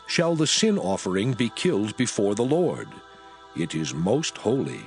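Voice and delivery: ordinary delivery, nothing marked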